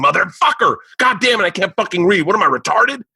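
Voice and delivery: high-pitched frustrated cursing rant; re-enacted fed-up swearing fit; exasperated outburst. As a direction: curse your own botched reading in a high, worked-up voice. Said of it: "they left in me going".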